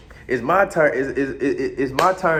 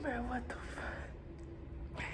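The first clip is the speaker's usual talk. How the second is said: whisper; 9 semitones higher, 22 dB quieter, much slower than usual. Retold or imitated. Stunned aside in bed.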